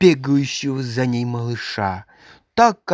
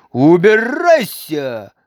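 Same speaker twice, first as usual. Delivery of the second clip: louder, slow furious yell; louder stretched-out Убирайся; shout; 3 semitones higher, 6 dB louder, slower than usual. A narrator's reading. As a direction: throw him out with your voice. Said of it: "крикнула она".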